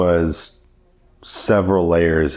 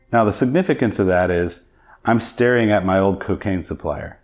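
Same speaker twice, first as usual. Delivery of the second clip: ordinary talk, nothing marked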